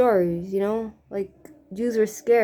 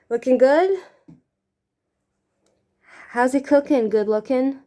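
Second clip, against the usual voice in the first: higher, louder, bouncy teasing; playful singsong; 4 semitones higher, 4 dB louder than usual. Pretend. Vlogger checking her makeup in a playful singsong. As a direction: tease yourself in the mirror. Playful rhyming self-talk in mirror.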